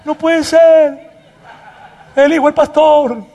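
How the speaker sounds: high, loud, drawn-out disbelief; mock shock. Real, not acted. Pastor reacts loudly to son's sarcastic note, in mock shock. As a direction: exclaim in amused outrage at your own son. Son mocked his own promise.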